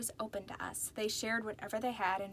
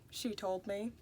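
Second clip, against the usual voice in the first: plain reading voice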